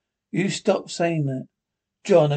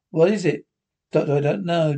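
ordinary talk

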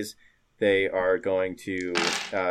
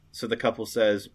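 ordinary talk

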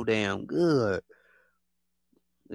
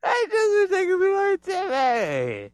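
much higher, slow teasing; mock singsong. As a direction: tease them sweetly. Mocking summary in high voice.

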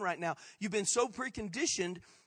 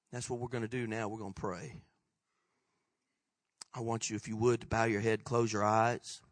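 low, soft pastoral invitation; very quiet, low guidance; hushed call to prayer; 9 semitones lower, 4 dB quieter than usual. Real, not acted. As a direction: gently lower the room into prayer. Leads congregation into prayer.

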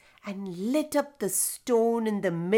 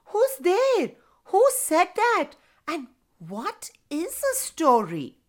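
put-on high startled boy's cry; aghast; 7 semitones higher than usual. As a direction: gasp it out, shocked and high. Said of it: "The boy was aghast".

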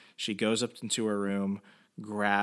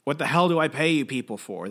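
mild mock yell, pitched higher; imitated angry screaming; 4 semitones higher, 5 dB louder, faster than usual. Mob boss to his people after the kidnapping, mock angry yell. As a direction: act out a boss's outburst, only part strength. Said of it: "Guido Bertinelli is just screaming".

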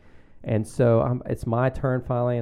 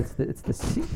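hushed; 11 semitones higher, 6 dB quieter than usual. Real, not acted. Naming a vulgar word.

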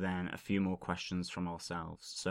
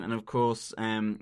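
ordinary talk

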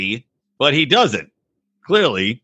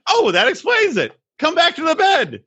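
much higher, sweetly pretend-accepting wife; high mock wife voice. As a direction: play the gullible wife, high. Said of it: "she should be like".

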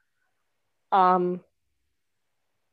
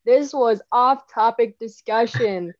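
louder podcast announcer outro; 6 dB louder than usual. Acted. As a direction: sign off like a radio host. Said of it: "my nice announcer voice ending".

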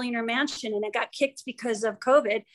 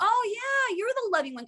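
delighted recognition; 8 semitones higher than usual. Retold or imitated. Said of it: "I'll go".

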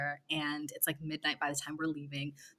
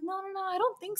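high mock guy voice. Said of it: "and he's like".

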